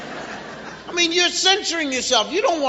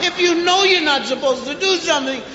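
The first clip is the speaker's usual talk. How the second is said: ordinary talk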